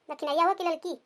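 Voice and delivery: ordinary talk, nothing marked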